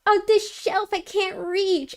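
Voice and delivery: hammed-up helpless stepsister; imitating the stepsister. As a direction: pitch it up like a needy little sister. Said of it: "she's like".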